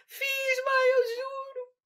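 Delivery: put-on high, crying little girl's oath; tearful pleading. Girl insists to her mother she wrote it, high and near tears.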